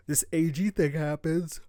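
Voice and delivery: talking through a yawn; words stretched by a yawn. Real, not acted. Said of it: "I just yawn w-, when I yap a lot".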